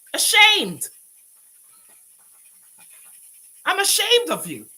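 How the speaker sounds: a real furious shout; shouted shame. Real, not acted. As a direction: blast "I'm ashamed of you" at her.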